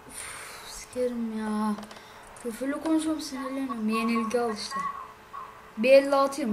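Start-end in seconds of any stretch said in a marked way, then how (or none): none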